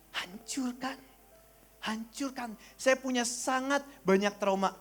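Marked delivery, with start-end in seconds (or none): 0.1-2.6 very soft, measured word; hushed divine command. Retold or imitated. God speaking to His child, hushed. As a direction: say it quietly, leaving space after.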